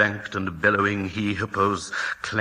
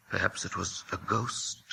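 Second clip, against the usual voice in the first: softer, wondering about a ghost; hushed guess; 3 semitones lower, 8 dB quieter than usual. Acted. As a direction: murmur it half-scared. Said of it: "Jim said".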